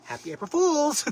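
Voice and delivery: high, loud gleeful shout; teasing shout. Real, not acted. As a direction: yell it gleefully to tease. Teasing call to Maxwell.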